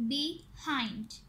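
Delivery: "behind" split into two beats; "behind" with a gap after "be"; syllable by syllable. Shown as a demonstration. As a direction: say "behind" as be, pause, hind. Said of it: "टू syllable words".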